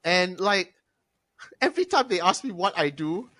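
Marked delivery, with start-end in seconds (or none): none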